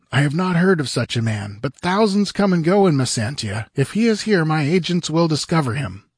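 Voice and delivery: fretful rich man fearing his past; anxious merchant. A narrator's reading. Publio questions Conan in his chamber, nervous and high.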